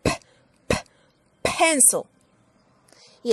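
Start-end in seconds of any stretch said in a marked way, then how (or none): 0.0-2.0 pencil with a big puff of air on the p; aspirated P. Shown as a demonstration. Teacher showing the airy p in pencil again. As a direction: blow the p of pencil out hard. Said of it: "con mucho aire".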